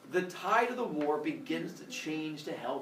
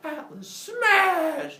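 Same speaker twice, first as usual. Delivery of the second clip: slow, loud, higher hulking bellow; Hulk-style smash; 12 semitones higher, 6 dB louder, much slower than usual. Acted. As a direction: bellow it slowly like a brute. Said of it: "And Atlas goes".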